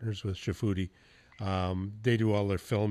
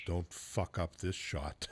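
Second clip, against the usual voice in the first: put-on low, soft, slow South African accent; quiet, slow warning in a South African accent; 5 semitones lower, 6 dB quieter, slower than usual. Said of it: "this in his South African voice".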